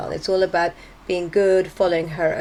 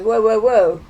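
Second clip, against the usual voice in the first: louder, startled backing off; a retold boyfriend panicking at commitment; mock alarmed whoa; 3 semitones higher, 6 dB louder than usual. To his girlfriend, he backs off in loud mock alarm. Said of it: "and he goes".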